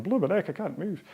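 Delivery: lightly hammed-up moan about aching; mock stiff-bodied complaint. Rower imitating himself hobbling round the house, mock complaint. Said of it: "hobbling around the house this morning going".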